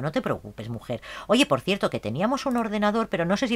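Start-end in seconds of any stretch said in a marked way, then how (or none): 0.0-1.0 much softer, lower soothing words; tender murmured reassurance; soft comfort. Acted. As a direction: hush her gently. Comforting crying sim.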